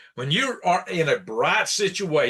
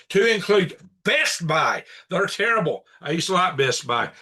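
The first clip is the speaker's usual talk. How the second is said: ordinary talk, nothing marked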